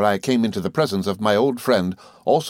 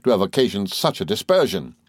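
ordinary delivery, nothing marked

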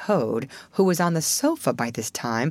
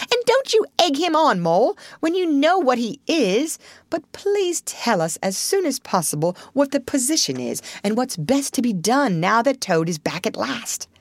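higher exasperated scold; scolding Rat; 8 semitones higher than usual. A narrator's reading. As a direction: hush an unruly friend. Rat speaks.

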